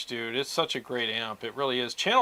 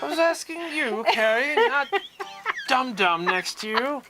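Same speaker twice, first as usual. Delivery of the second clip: mock British accent; 11 semitones higher, 3 dB louder than usual. Streamer reading a viewer comment, in a mock British scold. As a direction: read it like an outraged English gent. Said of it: "So he's asking".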